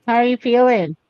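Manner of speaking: high, loud cooing question; pet talk. Real, not acted. Owner checks on a poorly pet, high pet talk.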